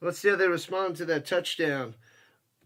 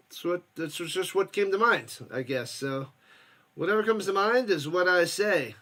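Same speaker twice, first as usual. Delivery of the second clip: ordinary talk, nothing marked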